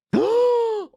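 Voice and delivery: a sharp shocked gasp, high and loud. Real, not acted. Streamer gasping as the rare card appears.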